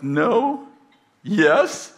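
high teary no, then a weaker yes; teary assistant. Retold or imitated. Crying assistant admits she is hurt, high and teary. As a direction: flip from denial to admitting it, tearfully. Weepy assistant answers pastor.

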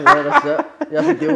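laughing loudly. Real, not acted. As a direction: laugh through the words. Laughing at subtitle joke.

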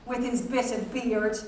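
ordinary delivery, nothing marked

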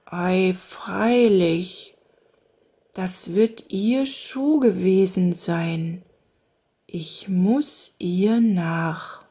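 lightly voiced slow woodcutter, to himself; musing slowly, half to himself; slow Jakob muttering. A narrator's reading. Jakob murmelt langsam vor sich hin. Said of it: "flüsterte vor sich hin".

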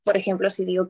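ordinary delivery

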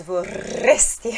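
vorresti slow and loud with a long rolled rr; double R. Shown as a demonstration. Teacher stretching the double R for students. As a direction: roll the rr in vorresti hard. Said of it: "os dois R's".

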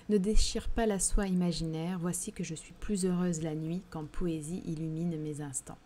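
reading the poem lines aloud to the class. Acted. Teacher reading a translated poem to her class, recited. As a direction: give the verse a recited lift.

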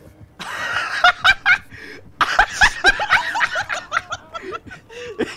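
shrieking laughter; long high laugh. Real, not acted. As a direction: cackle high.

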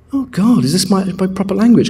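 high-pitched dawning realisation; mock amazement. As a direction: act out sudden astonishment. Acts out sudden amazed realisation.

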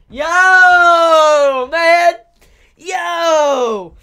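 high, loud, long-held cry of shock; real anguished shout. Real, not acted. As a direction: howl the words out in disbelief. Shocked reaction to sad twist.

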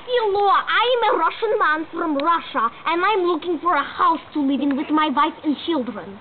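put-on Russian man; hammed-up Russian accent. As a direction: introduce yourself in a thick mock Russian accent. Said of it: "Russian accent".

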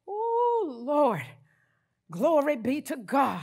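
ordinary delivery

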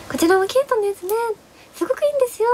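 high, eager sales-clerk voice; imitating the shop clerk. Clerk recommending the cute color, high eager clerk voice. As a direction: pitch it up like a clerk selling sweetly.

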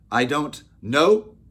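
very loud, high, slow scream. A narrator's reading. Opal confronting the boy over the promise, screaming. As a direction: scream it out, stretching each word. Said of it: "she screamed".

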